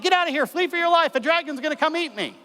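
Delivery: high, urgent warning from the tied woman; woman at the stake warns the soldier, high and urgent; frightened lady. Acted. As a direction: cry out to the stranger to flee. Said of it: "And she's like".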